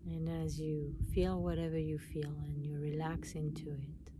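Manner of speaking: calm meditation voice with long pauses; guided meditation. Acted. Guiding listeners to relax and notice their breath, meditation voice. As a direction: guide them inward, unhurried, leaving space between phrases.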